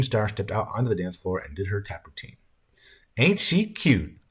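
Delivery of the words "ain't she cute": high, drawn-out cooing remark; Red Sam's wife. A narrator's reading. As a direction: coo it slowly, pitched well up. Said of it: "Red Sam's wife said".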